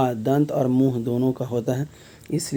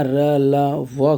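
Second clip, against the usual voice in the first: या, र, ला, व very slow, each letter apart; slow sounding out; much slower than usual. Shown as a demonstration. Teacher repeating the question's letters for students, slowly sounded out. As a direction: stretch each of या, र, ला, व separately.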